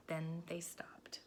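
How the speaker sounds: slow hushed whisper. A narrator's reading. Narrator whispering as everything goes still. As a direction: let the hush fall. Sudden stillness after the crash.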